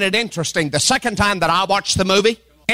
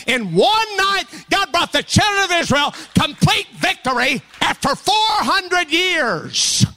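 higher and higher, slow shouted preaching; preaching at full force; 4 semitones higher than usual. Acted. Pastor telling the Exodus story to the church, building to a shout. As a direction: drive the story to its climax.

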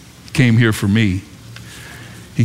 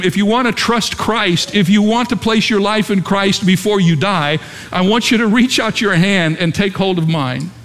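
higher-pitched, urgent appeal; pleading vicar; 8 semitones higher than usual. Retold or imitated. Vicar to dying parishioner at bedside, pleading. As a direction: plead with a dying man, voice lifted. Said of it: "he was pleading with this man".

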